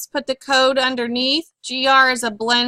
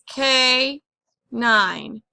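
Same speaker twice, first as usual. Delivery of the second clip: "K nine" drawn out with a pause between syllables. Shown as a demonstration. Teacher showing how canine divides, syllable by syllable. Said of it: "this syllable is accented".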